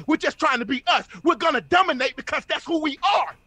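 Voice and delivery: full-force, rousing preacher delivery; motivational preaching. Real, not acted. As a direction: fire up the listeners like a preacher.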